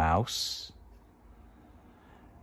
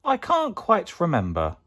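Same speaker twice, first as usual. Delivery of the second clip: 1 Gruffalo dad unsure; 10 semitones higher than usual. Acted.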